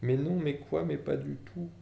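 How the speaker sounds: high, thin nervous mumble; Vernon, livid, mumbles a denial to Hagrid; muttering. A narrator's reading. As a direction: fumble the words in fright. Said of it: "marmonna quelque chose".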